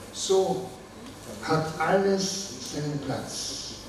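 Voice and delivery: measured verse with pauses after each line; poetry reading. Acted. Poet reading own German poem to an audience, measured recital. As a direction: recite it line by line, letting each image settle.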